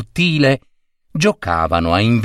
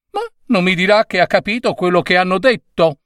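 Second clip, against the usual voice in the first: put-on high, fast disbelieving old woman; can't believe he understood; incredulous old lady; 8 semitones higher, faster than usual. Old lady doubting the gentleman, high and fast.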